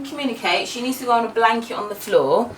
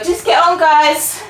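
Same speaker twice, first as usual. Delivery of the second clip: telling off; 9 semitones higher, 4 dB louder, slower than usual. Real, not acted. Mum telling girls to train.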